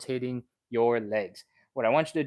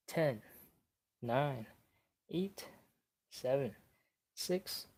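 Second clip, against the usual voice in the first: steady coach countdown, mostly softer, holding the stretch; 2 semitones higher, 4 dB quieter than usual. Acted. Instructor counting down the stretch for viewers, soft and steady. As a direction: count the seconds down calmly for the class.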